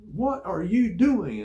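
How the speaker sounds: loud, high mock yell, each word stretched; retold yelling. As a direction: imitate an officer yelling at a recruit. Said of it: "he started yelling at me".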